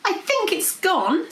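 high-pitched, anxious princess voice; nervous princess. A narrator's reading. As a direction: say it warily, high and tense. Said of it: "her heart thumping in her chest".

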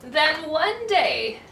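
ordinary delivery, nothing marked

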